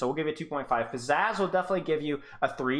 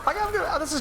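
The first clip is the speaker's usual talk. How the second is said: delighted squeal; faster than usual. Real, not acted. Delighted reaction to the clip.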